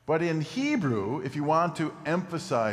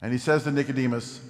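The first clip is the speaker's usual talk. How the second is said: ordinary talk, nothing marked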